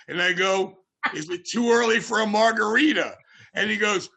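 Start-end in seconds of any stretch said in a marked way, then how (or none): 1.2-3.2 raised, cheeky ask; cheeky question. Retold or imitated. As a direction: push your luck playfully.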